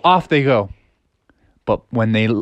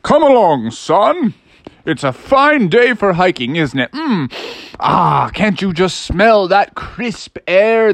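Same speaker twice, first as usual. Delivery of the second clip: hearty storybook father, pitch swooping high; jovial hiking dad; 5 semitones higher, slower than usual. Acted. Dad takes son hiking in mountain air, jovial. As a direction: savour it slowly like a happy outdoorsman.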